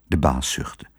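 usual speaking voice

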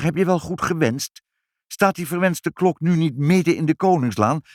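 high, agitated questioning; nervous. A narrator's reading. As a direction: fret that the wish went wrong. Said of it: "vroeg vader zenuwachtig".

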